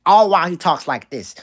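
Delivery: a lightly voiced mock Ben Shapiro; a hint of a light, mocking pundit voice; Ben Shapiro impression. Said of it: "make his voice a little light".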